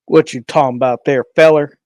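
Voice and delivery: put-on backwoods country accent, punched loud on the last word. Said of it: "more country, more redneck, more backwoods".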